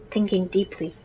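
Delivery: plain reading voice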